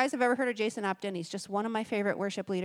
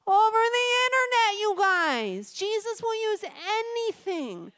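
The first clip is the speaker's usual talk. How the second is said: much higher and louder, bursting with wonder; excited exclamation; 11 semitones higher, 5 dB louder, slower than usual. Real, not acted. Telling the congregation God reached her online, excitedly amazed. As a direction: cry it out, amazed all over again. Preacher marvels at the story.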